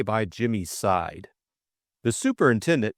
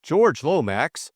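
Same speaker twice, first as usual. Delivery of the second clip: plain reading voice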